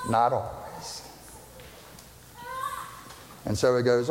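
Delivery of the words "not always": softer, slower "Not always" with a laugh; chuckling softly. Shown as a demonstration. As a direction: laugh it off quietly.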